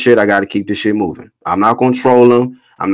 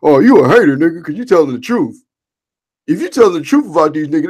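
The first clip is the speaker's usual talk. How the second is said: mocking quote; 4 semitones higher than usual.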